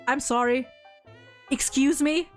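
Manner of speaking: ordinary talk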